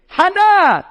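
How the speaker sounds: high, loud mock scream; retold scream. Rabbi imitates the wife to her husband, loud high scream. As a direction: belt out the name.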